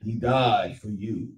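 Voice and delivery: imitating a solemn preacher, slowing down at the end; mock serious voice. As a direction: put on a grave, pious tone to mock them. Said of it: "They try to say it with a serious voice".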